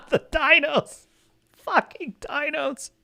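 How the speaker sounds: breaking into laughter over the words; laughing in disbelief; laughing scorn. Real, not acted. Laughing at the phrase.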